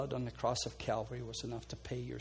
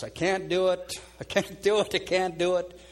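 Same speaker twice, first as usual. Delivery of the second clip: upset pleading; 5 semitones higher, 6 dB louder than usual. Retold or imitated. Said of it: "would be upset that she couldn't".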